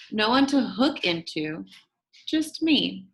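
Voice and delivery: usual speaking voice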